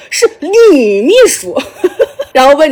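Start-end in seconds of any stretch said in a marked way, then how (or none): 0.1-1.6 higher, stretched Shanghai-style 女秘书; mock Shanghai accent. Guo Da correcting the audience, hammed-up Shanghai accent. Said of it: "郭达就用上海话说了".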